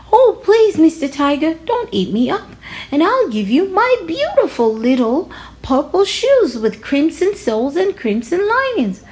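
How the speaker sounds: put-on high, pleading child's voice; pleading little boy. Little Rajani begging the tiger to spare him, high and pleading. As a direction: beg sweetly in a small high voice.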